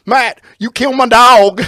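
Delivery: loud, high mock Southern shout; Jeff Hardy. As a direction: holler it like a talking tobacco can. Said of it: "his voice sounds like a Skull Can".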